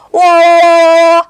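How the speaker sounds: loud, high, long-held whoa; playful wail. Retold or imitated. Aunt and baby's noise game, loud high drawn-out wail. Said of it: "And then we go".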